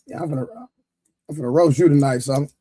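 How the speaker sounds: ordinary talk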